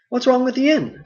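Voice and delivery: Frodo, mildly questioning; Frodo's voice. Acted. Frodo's reply.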